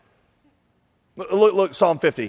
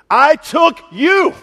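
slow, loud, high proclamation; booming slow proclamation; 10 semitones higher, 7 dB louder, much slower than usual. Acted. Preacher proclaiming God's words to David, slow booming. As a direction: stretch out each word and boom it.

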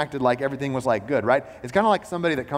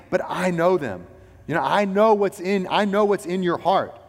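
raised, earnest voice of God speaking; voicing God; 7 semitones higher, 4 dB louder than usual. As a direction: speak as God reassuring the listener. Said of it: "God is saying".

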